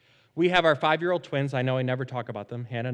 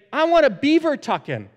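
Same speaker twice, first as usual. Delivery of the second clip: loud, high imitation of an excited kid; eager child's request; 9 semitones higher, 8 dB louder than usual. Twins asking dad for a tuck-in, excited imitation. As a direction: call out like a child demanding a bedtime game.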